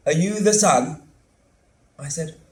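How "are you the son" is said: blunt demand. Acted. Daughter confronting him at door.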